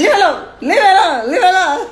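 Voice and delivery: high, loud mocking replay; mock your own earlier yelling; mimicked angry protest. Woman laughing at how she had protested, mock shouting. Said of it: "The same person that was saying".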